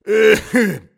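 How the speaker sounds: very loud, high hacking fit; old man's cough. Acted. Old husband interrupts himself before his wife, violent cough. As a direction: burst into a long acted coughing fit.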